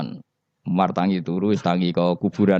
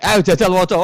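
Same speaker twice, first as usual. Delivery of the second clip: higher and louder, laughing through the order; 10 semitones higher, 6 dB louder than usual. Acted. Preacher voicing Munkar Nakir demanding Umar read, laughing. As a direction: bark it out while cracking up.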